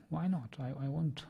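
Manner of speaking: ordinary talk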